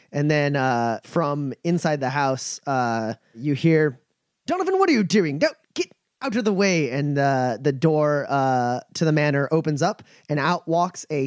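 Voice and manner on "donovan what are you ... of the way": calling out. Acted. Voice calls from inside house.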